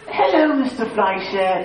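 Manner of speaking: slow, mock British phone greeting; imitated British caller; British accent. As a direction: put on a polished British accent, drawn out. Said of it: "some kind of British accent".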